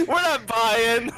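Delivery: squealing high laughter; laughing. Real, not acted. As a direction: crack up at the player. GM laughing at player.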